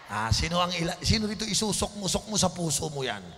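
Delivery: loud, fervent preacher delivery; preacher urging boldness, at full force; full-force preaching. Acted. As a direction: preach it out to the congregation.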